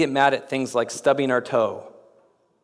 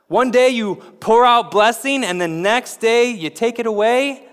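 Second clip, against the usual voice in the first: high, loud, aggrieved protest; a hammed-up petulant outcry; indignant complaint; 7 semitones higher, 4 dB louder than usual. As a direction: whine at God like a wronged man. Jonah voiced complaining to God.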